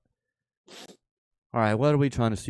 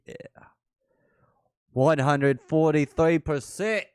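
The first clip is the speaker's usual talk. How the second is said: ordinary talk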